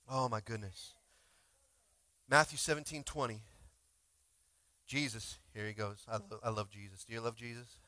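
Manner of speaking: normal talking voice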